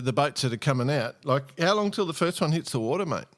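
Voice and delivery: usual speaking voice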